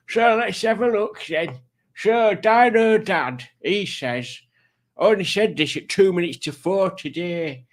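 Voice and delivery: high, loud put-on voice with slurred s sounds; put-on character voice. Streamer reading out a viewer's comment, in a put-on character voice. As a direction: put on a silly high voice, esses going sh. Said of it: "doing this voice".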